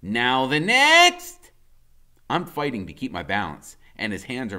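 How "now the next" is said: higher, very slow and louder; Freak's voice. A narrator's reading. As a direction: draw each word out, guiding a step. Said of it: "he says".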